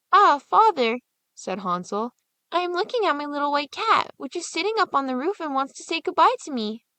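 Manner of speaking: put-on a little boy making excuses; boy's voice. Hansel answering his father, high boyish voice.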